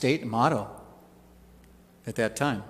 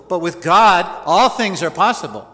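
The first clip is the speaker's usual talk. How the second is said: louder, punching with God; booming scripture line; emphatic quote; 3 semitones higher, 7 dB louder than usual. Acted. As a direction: proclaim it to the congregation. Preacher quoting Jesus, emphatic.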